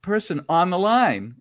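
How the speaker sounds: loud, high imitated on-air announcement; radio host announcing. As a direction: announce the guest like a DJ back on air. Imitates DJ back from commercial.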